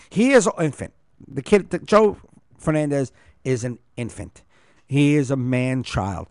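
usual speaking voice

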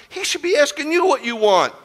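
high, loud, preacherly outrage; indignant shouting. Retold or imitated. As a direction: scold at full volume on her behalf. Indignant retort on old lady's behalf.

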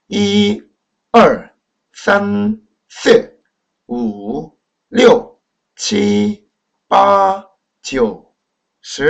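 each numeral apart, tones clearly drawn; Mandarin pronunciation demo. Shown as a demonstration. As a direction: count in Mandarin with exaggerated tones. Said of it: "먼저 제가 발음을 한번 해보도록 하겠습니다".

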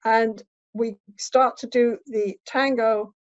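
usual speaking voice

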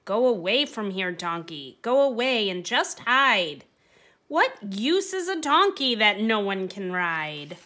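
loud, high, scornful scolding; angry dismissal. Acted. Mean owner chasing the donkey out of his sight, loud angry scolding. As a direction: drive the useless donkey off with contempt. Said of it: "said with a huff".

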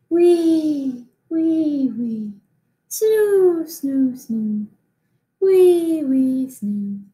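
high wee and snoo snoring sounds; playful snore noises. Acted. Teacher makes the baby mummy's snoring noises for the class. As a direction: snore in silly little squeaks.

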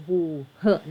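"who เหอะ", word then its opening H sound; pronunciation demo. Shown as a demonstration. Teacher explaining the first sound of who, H-sound demo. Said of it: "อันนี้ออกเสียง".

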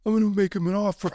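lightly voiced mafia don; bad Godfather impression. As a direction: ham up the Godfather line. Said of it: "That was terrible acting".

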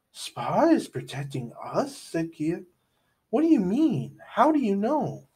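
high, alarmed questions; anxious girl voice. A narrator's reading. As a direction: ask it like a startled kid. Kia's worried questions.

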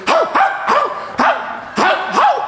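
a sudden high-pitched hou, hou, hou; high calling voice. Retold or imitated. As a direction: yelp it out high and sharp. Sudden shouting heard at the pumpkin.

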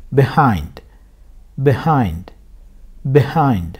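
Behind said with a short be, stress on hind; teacher models Behind; model pronunciation. Shown as a demonstration. Teacher drills Behind for students, stressing hind. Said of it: "B diye okuyorsun".

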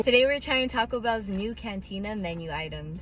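soft, hushed car review; quiet voice. Real, not acted. Quiet taste-test talk in the car.

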